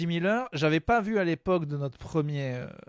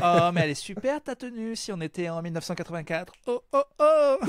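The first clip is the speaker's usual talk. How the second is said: gush, then cackle in mock disdain; Christina Cordula; 2 semitones higher than usual. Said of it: "C'est Christina Cordula, en fait".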